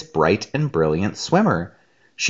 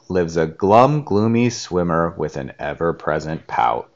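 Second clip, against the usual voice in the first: put-on reading a picture book to a child; gentle read-aloud narration; storybook reading; 4 semitones lower than usual. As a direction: set the undersea scene like a bedtime story. Mother reads picture book aloud.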